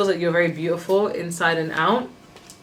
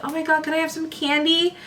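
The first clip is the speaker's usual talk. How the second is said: put-on much higher kid voice, sweetly begging; American movie kid; 10 semitones higher than usual. Non-American reader caricaturing an American movie kid, high begging. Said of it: "watching American movies and you're like".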